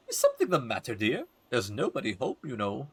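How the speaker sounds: put-on lilting old lady voice; gossipy old woman. Old neighbor addresses a boy at the door, lilting concern. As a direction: play a concerned elderly neighbor.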